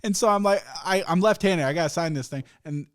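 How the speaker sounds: ordinary talk, nothing marked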